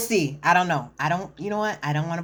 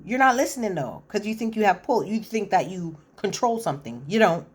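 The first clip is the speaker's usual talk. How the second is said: normal talking voice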